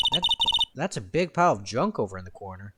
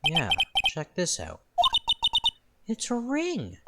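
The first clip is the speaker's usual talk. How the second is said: put-on much higher bubbly girl voice; excited girl; 12 semitones higher than usual. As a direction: gush like an eager young assistant. Assistant finds a ring.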